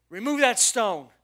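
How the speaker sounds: acted booming order; loud command. Jesus ordering the people at the tomb, loud command. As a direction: call out the order with force.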